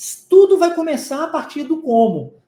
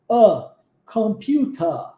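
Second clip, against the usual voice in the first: slow British "computer" ending in open "ah"; British accent, drop the final R, draw it out; British English; much slower than usual. Shown as a demonstration. Teacher showing the open ER of Britain, slow British "computer". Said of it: "esse E R mais aberto".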